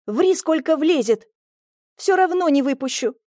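self-assured, lifted-pitch dismissal; confident. A narrator's reading. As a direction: refuse firmly, sure of yourself. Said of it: "уверенно сказала я".

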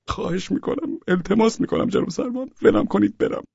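soft, high-pitched begging; thin, helpless plea; pleading. A narrator's reading. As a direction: beg the officer, close to tears. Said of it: "به افسر نگهبان التماس کردم".

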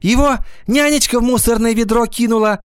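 high, worried schoolgirl voice; agitated. A narrator's reading. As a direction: burst out with your theory nervously. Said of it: "волнуясь, заговорила Гвоздёва". (волнуясь, высоким голосом)

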